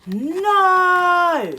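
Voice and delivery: high, loud defiant shout; big defiant NO. Acted. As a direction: yell it like a stubborn kid. Child refusing to be quiet.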